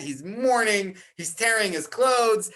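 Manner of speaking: normal talking voice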